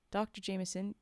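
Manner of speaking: plain reading voice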